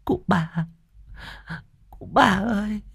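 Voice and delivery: high, soft sobbing call; crying out sobbing. A narrator's reading. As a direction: wail for the great-grandfather through tears. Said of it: "khóc òa cả lên".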